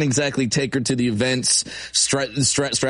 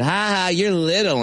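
high, mocking kid's jeer; taunting schoolkid; 7 semitones higher than usual. Kid picking on him on the first day of school, high taunt. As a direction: tease like a playground bully, pitched up. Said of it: "a kid came up ... and said".

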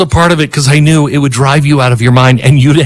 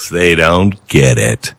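mock dismissive young voice; 10 semitones lower, 3 dB quieter, slower than usual. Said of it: "her generation to go".

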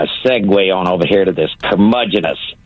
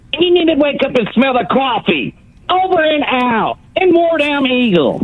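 sudden cartoonish falsetto, much higher; squeaky high voice. Caller mocking a rival host, sudden high squeaky sign-off.

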